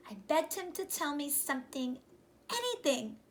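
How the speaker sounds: ordinary delivery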